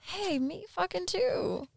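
imitating a listener. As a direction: squeak it out in a listener's voice. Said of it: "they're like".

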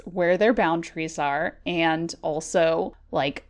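normal talking voice

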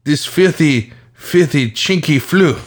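lightly voiced African guy, mock indignant; African accent. Podcast host jokes to cohost in a light African accent. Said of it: "African voice".

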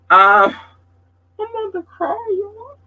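very high, small tearful squeak; about to cry. Real, not acted. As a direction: voice climbs away as tears threaten. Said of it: "I don't wanna cry".

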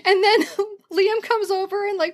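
high, choked-up happy voice; tearful laughter. Real, not acted. Author retells crying over her book, tearful. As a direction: laugh through real happy tears.

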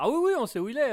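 high, loud eager answer; eager mock reply. Acted officers answering eagerly.

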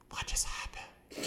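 imitated whisper in a silent theatre; audience whisper. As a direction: whisper slowly, as a confused spectator.

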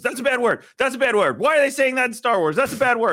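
put-on scandalized kid, very high and loud; Hunter. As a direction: squeal it out like a tattling child. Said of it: "and Hunter goes".

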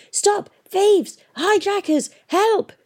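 very high-pitched cries of alarm; shouting. A narrator's reading. Balloonist raises the alarm as thieves take off, shouting. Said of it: "the balloonist was shouting".

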